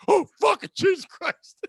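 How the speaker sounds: sudden high exasperated cry; exasperated outburst. Retold or imitated. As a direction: blurt it out in frustration. Exasperated outburst at grocery store.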